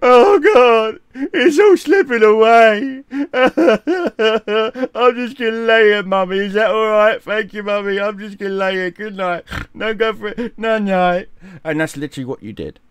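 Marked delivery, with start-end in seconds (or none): 0.0-3.1 loud, high mock sobbing; exaggerated crying wail, breaking into sobs; wailing beaten boxer. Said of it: "you're just laying there going". 4.9-11.3 hammed-up childish whimper, pitched up; tearful little-boy mock voice; whimpering to mummy. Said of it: "you're just laying there going".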